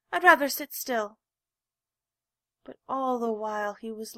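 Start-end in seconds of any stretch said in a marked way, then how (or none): 0.1-1.2 loud, higher refusal; refuse, too loudly; stubborn protest. A narrator's reading. Little rabbit refuses to dance, loud. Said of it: "he said".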